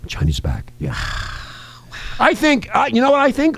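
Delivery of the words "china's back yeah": very low, soft eerie voice; low scary voice. Acted. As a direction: breathe it out low and spooky. Scary voice joke.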